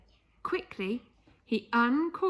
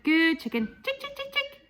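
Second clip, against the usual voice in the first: high, sing-song chick-calling, louder praise first; coaxing the chicken; 7 semitones higher, 3 dB louder than usual. Acted. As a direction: sweet-talk the hen closer. Coaxing the hen.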